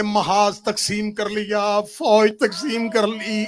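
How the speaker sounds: loud, slow chanted wail; full-force lament. Acted. Orator chanting Zainab's plea to the mourners. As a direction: wail it out like a dirge.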